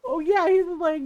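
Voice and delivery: usual speaking voice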